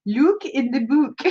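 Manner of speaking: slow, silly British accent gone Swedish; British accent drifting into Swedish Chef; terrible British accent. Shown as a demonstration. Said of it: "my British accent sounds like the Swedish chef".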